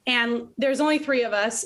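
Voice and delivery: usual speaking voice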